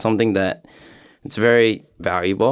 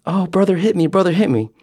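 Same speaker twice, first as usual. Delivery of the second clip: high, whiny fake-crying little sister; 9 semitones higher than usual. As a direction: whine like a tattling kid. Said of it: "And she's like".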